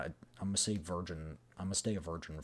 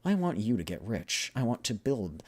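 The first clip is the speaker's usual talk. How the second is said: lightly acted, emphatic negotiation; earnest, lifted dialogue; deal-making game characters; 3 semitones higher, 8 dB louder than usual. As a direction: press the point in character. Voices two characters making a deal.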